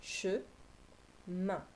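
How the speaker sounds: "Che" then "main" as separate beats; "Che" and "main" said apart with a pause; syllables split. Shown as a demonstration. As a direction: cut the word into two syllables. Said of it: "Il y a deux syllabes".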